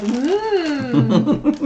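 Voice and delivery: a drawn-out "mm" as a famished labourer; hungry field workers' mm. Acted. Weary field workers tasting their food, long mock-savouring hum. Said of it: "people who have been in the fields".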